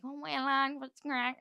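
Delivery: a hint of the rapper's friendly hello to each of the lads. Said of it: "he's going around everyone".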